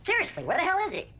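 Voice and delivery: high, quick, exasperated demand; fed-up, high-pitched question; impatient outburst. Acted. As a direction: demand it, out of patience. Waiting for a missing player.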